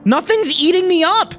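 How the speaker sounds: high-pitched angry denial; defensive outburst. Acted. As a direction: deny it hotly, pitch leaping up. Snaps back in acted quarrel.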